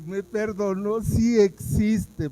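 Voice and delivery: high, loud, slow joyful exclamation; loud, elated declaration; overflowing joy. Retold or imitated. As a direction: let the joy burst out. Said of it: "no aguantaba el gozo".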